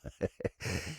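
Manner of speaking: quiet, breathy chuckle; soft chuckle. Acted. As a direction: chuckle softly at yourself. Laughs at own speech.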